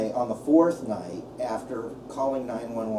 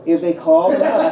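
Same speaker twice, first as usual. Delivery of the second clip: half-laughing, louder; 4 semitones higher, 11 dB louder than usual. Real, not acted. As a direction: laugh as you say it.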